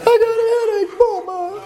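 high, whimpering plea for mama; high babyish whimper to mama; whiny man-baby. Retold or imitated. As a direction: whine like a sick little boy. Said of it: "All us men get that way sometimes, kinda like".

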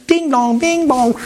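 high chiming ding dong; ping it like phones coming back online; phone alert chimes. Retold or imitated. Phones buzzing after landing.

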